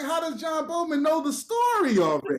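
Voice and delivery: high-pitched indignant outburst; worked-up retold question; mad. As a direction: blurt it in outraged disbelief. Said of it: "So I'm mad".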